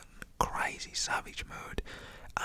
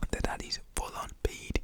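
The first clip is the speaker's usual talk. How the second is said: ordinary delivery, nothing marked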